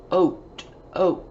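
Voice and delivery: "Oat" as an isolated rime, B left off; word ending alone. Shown as a demonstration. Teacher showing pupils a rhyme, "Oat" sounded alone. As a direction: sound out "Oat" crisply, with pauses. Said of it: "Let's take away the B".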